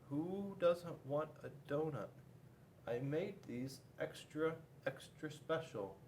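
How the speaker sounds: quiet disappointed baker; soft hurt reply. Acted. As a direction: wonders who'd refuse a donut.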